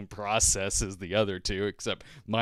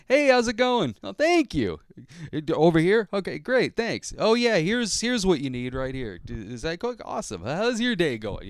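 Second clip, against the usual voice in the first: higher, bright chirpy customer friendliness; happy voice; 8 semitones higher than usual. Retold or imitated. Retelling his polite small talk at the DMV, in a happy voice. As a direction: sound cheerful so nobody snaps at you. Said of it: "speak with a kinda happy voice".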